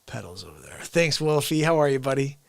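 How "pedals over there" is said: soft unvoiced whisper. Real, not acted. Streamer whispering while fiddling with gear, whisper. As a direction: keep it hushed. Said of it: "whispering near the mic".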